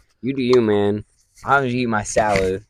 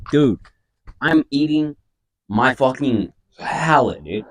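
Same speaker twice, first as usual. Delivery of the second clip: incredulous; 6 semitones higher, 4 dB louder, slower than usual. Retold or imitated. His own retold reply to bully.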